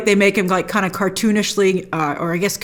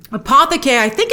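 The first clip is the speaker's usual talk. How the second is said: normal talking voice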